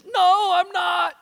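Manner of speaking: high, slow frightened yell; tearful shout. Retold or imitated. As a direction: shout no like a panicked boy. Boy refusing loudly.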